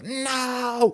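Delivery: high, drawn-out comic "no"; mock movie scream. Imitating an Austin Powers style death, mock scream. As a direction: send up a slow-motion film scream. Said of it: "It was a little bit Austin Powers, like, where he goes".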